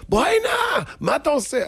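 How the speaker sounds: high-pitched acted shout; angry scolding. Family yells after the boy who wrecked the house, angry shouting. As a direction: shout as if chasing a naughty child. Family angrily chasing the child.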